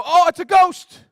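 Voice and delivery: high, loud frightened cry of Oh; terrified shriek. Retold or imitated. Disciples in the boat seeing Jesus on water, shrieking in fright. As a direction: shriek it like you've seen a ghost. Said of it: "They go".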